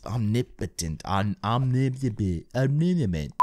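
"omnipotent" stumbled over, syllables garbled; stumbling pronunciation. Real, not acted. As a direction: trip over "omnipotent" several times. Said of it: "That's a really hard word to say".

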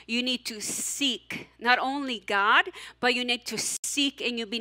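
ordinary delivery

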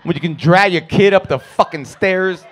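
usual speaking voice

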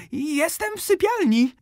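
put-on the wolf squeaks like an old lady; wolf in high voice. A narrator's reading. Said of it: "zawołał wilk wysokim głosem". (wilk woła bardzo wysokim głosem babci)

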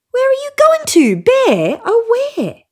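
very high, loud little rabbit voice; rabbit calling out. A narrator's reading. Rabbit calling after the fleeing bear, high and loud. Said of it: "The rabbit calls out to the big brown bear".

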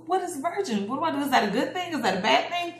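mock-worried kid thinking aloud; mildly worried wondering. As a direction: fret through anxious questions to yourself. Child's puzzled inner panic, retold.

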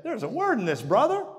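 high, lively quote of a friend; quoting an excited friend. Retold or imitated. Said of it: "he said".

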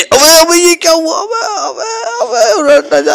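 very high panicked shriek with laughter. Acted. Car about to flip over, panicked high shriek. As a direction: squeal as the car tips.

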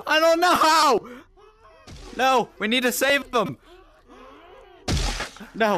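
high, loud frantic wail; shrieked urgency; panicked shout. Real, not acted. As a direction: yell in alarm, stretching the words. Panic over screaming victims.

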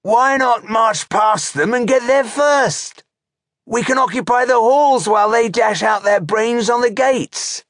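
very high, loud orc voice; defiant orc trooper. A narrator's reading. Insolent trooper challenging his chieftain's plan, defiant.

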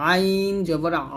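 আইন and আ drawn out with mid-throat pressure; squeezed ayn. Shown as a demonstration. Teacher repeating ayn for learners, squeezed long আ. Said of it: "মাঝখান থেকে চাপ দিয়ে বলতে হবে".

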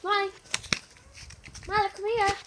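loud, high call. Real, not acted. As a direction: shout for the dog to come. Calling dog over.

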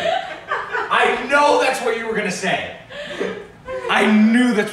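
high, loud, laughing through the words. Real, not acted. Laughing at his friend's predictable joke, loud laughing. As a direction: crack up while telling him off.

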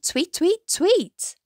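high, chirped tweets; bird tweeting. A narrator's reading. Mouse recalling the bird's part, high imitated tweeting. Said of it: "this little bird went".

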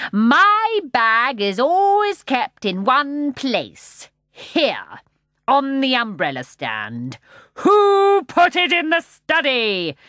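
loud, slow, preachy roar; roaring sermon. A narrator's reading. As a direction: thunder it like a sermon. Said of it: "he roared".

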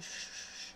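very soft unvoiced shh; repeated hushed brushing sound; crafting shush. Shown as a demonstration. Crafter showing the sound that helps crafting, soft shushing. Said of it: "You gotta make that sound".

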